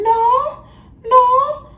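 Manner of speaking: very high, loud, drawn-out squawks; mock her with piercing repeated refusals; exaggerated shrieky imitation; mimicking coworker. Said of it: "that's dead ass how she used to talk".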